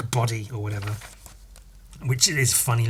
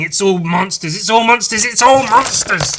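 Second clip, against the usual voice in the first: 1 building excitement; 8 semitones higher, 8 dB louder than usual. Real, not acted. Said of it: "building repetition".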